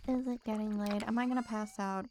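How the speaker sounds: ordinary talk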